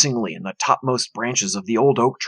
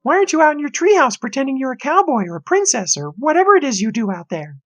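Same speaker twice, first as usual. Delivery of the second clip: mocking sister; 13 semitones higher, 4 dB louder, faster than usual. A narrator's reading. Sister mocks brother.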